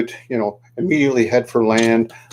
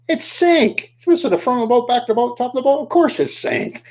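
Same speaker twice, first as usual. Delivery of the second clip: much higher-pitched exclaiming; emphatic reveal; 12 semitones higher than usual. A narrator's reading. Storyteller revealing the boat sank, emphatic high exclaiming. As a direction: exclaim it as if it were obvious.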